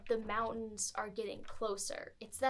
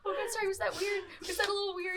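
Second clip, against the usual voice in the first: anxious giant, high and tearful; 9 semitones higher, faster than usual. Acted.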